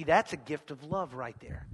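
ordinary talk, nothing marked

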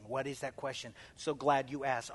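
ordinary talk, nothing marked

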